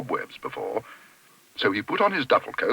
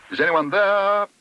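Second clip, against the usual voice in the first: high, loud hail; calling out; 9 semitones higher, 5 dB louder, slower than usual. A narrator's reading. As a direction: call through a door to whoever is inside. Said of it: "he called".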